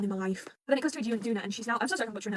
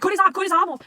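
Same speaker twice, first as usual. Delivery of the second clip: high, loud panicked command; urgent shouted order; 9 semitones higher, 12 dB louder than usual. Acted. Acted urgent order in retelling.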